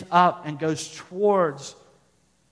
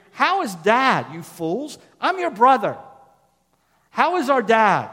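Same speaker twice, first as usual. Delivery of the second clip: raised, higher, urgent voice as Joseph; Joseph pleading loudly; 6 semitones higher, 7 dB louder than usual. Acted. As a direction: cry out to his brothers, half-hearted in tone. Preacher voices Joseph to his brothers.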